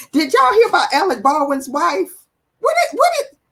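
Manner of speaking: laughing, high-pitched disbelief; shocked gossip. Real, not acted. Host to viewers, laughing in shocked disbelief. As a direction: squeal the news in laughing shock.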